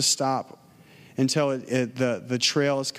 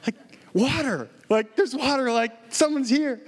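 high-pitched, thrilled outburst; excited retelling, voice leaping up; 11 semitones higher than usual. Retold or imitated. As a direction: blurt it out, thrilled to find someone. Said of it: "I was so excited".

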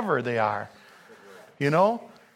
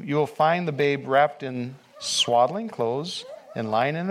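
measured, lower reading voice for the Gospel; lower, unhurried Bible reading; scripture reading; 4 semitones lower than usual. Acted. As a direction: read it out solemnly from the pulpit. Reads the Christmas story aloud.

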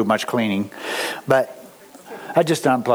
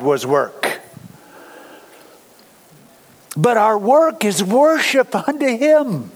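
preaching at full force; 8 semitones higher than usual. Acted. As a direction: preach it with fire.

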